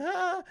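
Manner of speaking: high-pitched mock laugh burst; squealed "ah" of laughter; demonstrated laugh. Shown as a demonstration. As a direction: fake a big open laugh, way up high. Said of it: "I'll be laughing like this to you".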